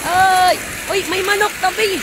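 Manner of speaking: sudden high yelp of surprise, then warning; startled shout. Real, not acted. Vlogger startled by a chicken on the road, yelping. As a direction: jump at the chicken and yell to move aside.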